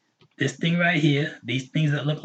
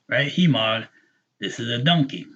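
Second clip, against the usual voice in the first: ordinary talk, nothing marked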